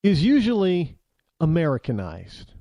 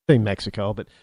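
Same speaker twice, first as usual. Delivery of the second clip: ordinary talk, nothing marked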